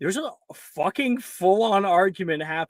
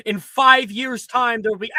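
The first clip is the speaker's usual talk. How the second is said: dramatic doom; 7 semitones higher, 7 dB louder than usual. Acted. Player announces doom dramatically.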